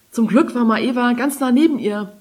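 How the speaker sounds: usual speaking voice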